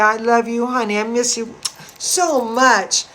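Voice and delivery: usual speaking voice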